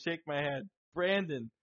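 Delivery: usual speaking voice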